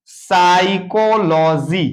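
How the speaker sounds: Psychology drawn out syllable by syllable; very slow psychology, the p silent and the ending held up; slow syllable breakdown. Shown as a demonstration. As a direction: stretch each part of psychology for learners. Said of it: "देखेंगे".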